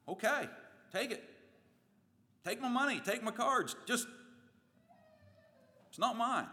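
high, loud panicked surrender; frightened victim. Acted. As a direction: give it all up in a high panic. Robbery victim handing over wallet.

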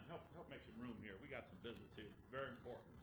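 low, very soft aside; quiet instruction. Retold or imitated. Jesus asking disciples to make room, low and quiet. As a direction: murmur it discreetly to close helpers.

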